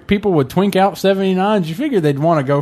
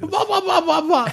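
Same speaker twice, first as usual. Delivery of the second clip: high creature babble. Pretend. Mocking gnoll chatter, laughing.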